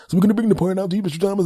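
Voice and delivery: high, rambling excuse-making rep voice; high mock customer service voice; babbling company rep. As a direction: pile up nonsense excuses cheerfully.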